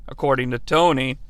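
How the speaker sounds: ordinary talk, nothing marked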